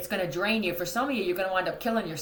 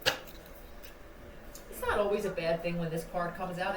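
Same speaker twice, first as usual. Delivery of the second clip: normal talking voice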